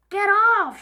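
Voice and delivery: high, loud shout. Acted. Henry shouting at Peter over the seat. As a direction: bark him out of the seat. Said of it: "shouted Henry".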